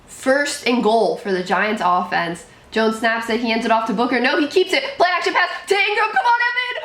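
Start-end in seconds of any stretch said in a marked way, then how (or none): 4.2-6.1 rising, fast play-by-play rush; excited play call. Real, not acted. 6.2-6.8 high yelling cheer at the player; cheering shout. Real, not acted. Cheering player during play.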